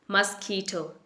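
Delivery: मस्कीटो as muss-KEE-toh; correct pronunciation. Shown as a demonstration. Teacher giving the right way, मस्कीटो as muss-KEE-toh. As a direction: softly stretch मस्कीटो, weak "mus", long stressed "kee". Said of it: "इसकी जो सही pronunciation है".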